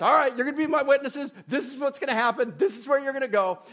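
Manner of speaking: high, loud, fast rallying orders; excited coach briefing the squad. Retold or imitated. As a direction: send the team out with energy. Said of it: "He says".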